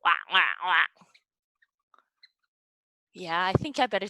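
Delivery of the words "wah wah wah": low, quick duck quacks; adult duck quack. Pretend. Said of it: "Adult ducks say".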